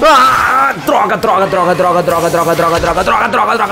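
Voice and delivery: panicked high pleading while fleeing; frantic begging to be left alone; being chased. Real, not acted. Chased by monster.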